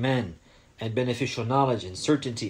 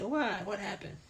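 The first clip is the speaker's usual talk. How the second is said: higher, then fading soft; curious parent; 9 semitones higher, 8 dB quieter than usual. Shown as a demonstration. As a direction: ask like a parent puzzled and curious. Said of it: "he's gonna say".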